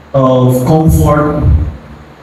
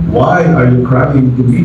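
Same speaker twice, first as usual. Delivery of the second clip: voice of the Lord; 7 semitones lower than usual. Acted. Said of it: "The Lord said to Moses".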